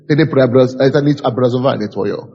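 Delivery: ordinary delivery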